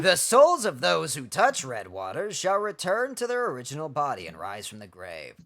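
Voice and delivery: low, solemn scripture voice; ominous prophecy reading. Acted. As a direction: read it as dark prophecy. Reads game prophecy text.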